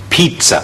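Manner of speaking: pizza with a ts sound, stressed on pi; teacher models pizza; correct pronunciation. Shown as a demonstration. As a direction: say pizza as pit-sa, first syllable strong. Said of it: "因此個讀法呢係".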